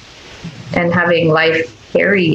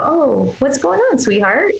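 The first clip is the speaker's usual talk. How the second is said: tender comfort; 7 semitones higher than usual. Retold or imitated. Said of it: "You listen, you go".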